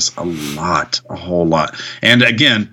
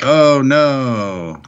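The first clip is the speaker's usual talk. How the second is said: drawn-out, louder, higher "oh no"; hammed-up dismay, pitched up; mock dismay; 6 semitones higher, 5 dB louder than usual. Acting out Roman's dismayed reaction.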